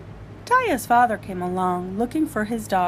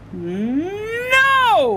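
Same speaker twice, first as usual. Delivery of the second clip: high, stretched no; stubborn father yelling no, laughing; shouted no; 11 semitones higher, 5 dB louder than usual. Acted. Father in back-and-forth quarrel.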